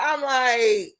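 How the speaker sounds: usual speaking voice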